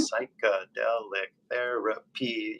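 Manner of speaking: "psychedelic therapy" drawn out slowly in separate syllables; slow teaching breakdown of "psychedelic therapy". Shown as a demonstration. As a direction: spell out "psychedelic therapy" by ear: sy-kuh-del-ic ther-a-py. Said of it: "how do you actually say it?".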